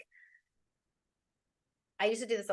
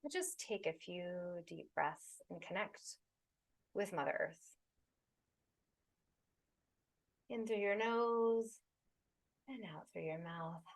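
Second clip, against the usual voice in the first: slower, softer meditation voice with long pauses; unhurried, soothing breathwork guidance; guided meditation; 3 dB quieter than usual. Acted. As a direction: guide them gently into the body and breath.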